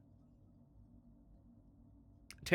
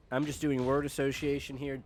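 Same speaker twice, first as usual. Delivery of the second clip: normal talking voice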